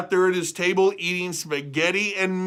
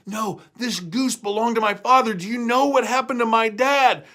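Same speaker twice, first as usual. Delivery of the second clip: Jack pleading; 7 semitones higher, 4 dB louder than usual. Acted.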